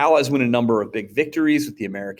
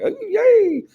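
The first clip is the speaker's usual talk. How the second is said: squeaky high yay, much higher; a mock eager cheer way up high; 4 dB louder than usual. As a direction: naive excited cheer. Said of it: "We're like".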